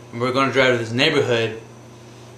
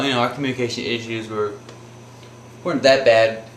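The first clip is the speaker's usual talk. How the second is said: ordinary talk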